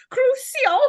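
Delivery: CRUCIO said very high-pitched; weird Voldemort delivery. Retold or imitated. Mocking how Voldemort tortures Harry, weirdly high "Crucio". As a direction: squeak out "Crucio" as an odd high cry. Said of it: "the way that he says Crucio is so weird".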